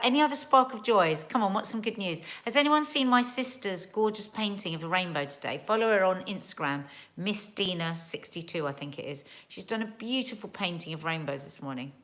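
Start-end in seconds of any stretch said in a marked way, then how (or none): none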